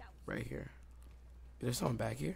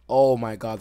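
excited; 14 dB louder, slower than usual. Real, not acted. Finding all enemies in room.

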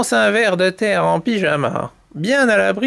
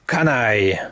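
roaring panther; 10 semitones lower than usual. Acted. Panther insults whoever dripped on her paw, low growl. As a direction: growl it deep like a big cat. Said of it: "rugit la panthère".

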